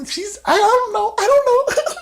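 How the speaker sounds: tiny, very high falsetto; mock giggly troll. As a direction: play dumb in a squeaky mock voice. Retelling giggling, trolling woman's reply.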